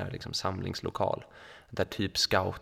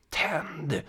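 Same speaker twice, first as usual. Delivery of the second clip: sharp high mock yell of one word; retold yell; 8 semitones higher than usual. A girl in a sequin dress yells at the narrator, mock yell. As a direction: bark the order high like the angry girl did. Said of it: "Hon skrek".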